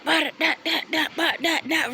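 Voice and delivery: mock someone ranting in nonsense syllables; mimicked chatter. Mimicking her gesturing rant.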